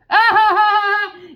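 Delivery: high loud scornful laugh; mocking laugh. Real, not acted. Reader laughs at being called fanatic, scornfully.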